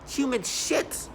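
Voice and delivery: slow, high contempt; slow disgust. Real, not acted. Naming his tormentors, slow high disgust. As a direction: spit it out slowly in disgust.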